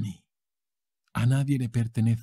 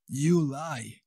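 ordinary talk, nothing marked

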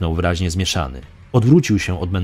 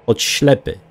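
usual speaking voice

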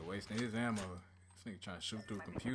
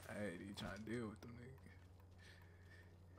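whispering; 11 dB quieter, much slower than usual. Real, not acted. Muttered aside while fleeing.

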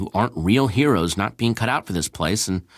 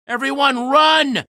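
shout; 5 dB louder, slower than usual. Acted. Panic, urging group to flee.

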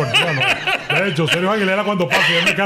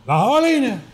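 catchphrase call; 8 semitones higher than usual. Retold or imitated. Narrator recreating his on-air home run call. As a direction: belt it out like a sportscaster. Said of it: "me empecé a utilizar".

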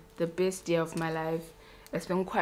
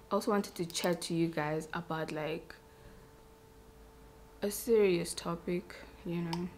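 softer, serious tone for a heavy topic; serious quiet voice; 8 dB quieter than usual. Real, not acted. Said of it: "even my voice changes".

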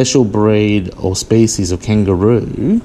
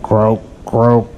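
croaking frog. A narrator's reading. Frogs chorus in story.

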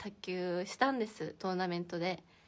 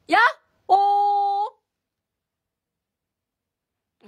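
a high, booming wake-up cry; loud cheery yell to startle sleepy viewers; sudden loud yell; 10 semitones higher, 16 dB louder, much slower than usual. Real, not acted. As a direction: shout it out at full volume to wake everyone. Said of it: "急な大声はやめろ".

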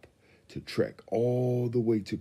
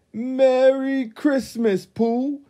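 high, loud, slow shout; shouted greeting; 10 dB louder, much slower than usual. A narrator's reading. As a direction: call it out big and slow. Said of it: "his friend shouted".

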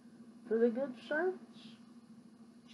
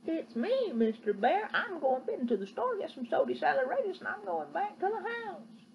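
put-on higher, eager little girl voice; little girl answering the bear; 6 semitones higher than usual. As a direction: answer brightly like a small girl.

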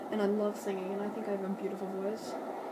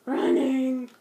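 shouted; 12 dB louder than usual. Real, not acted. Shouting while playing.

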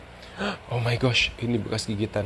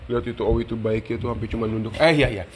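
ordinary talk, nothing marked

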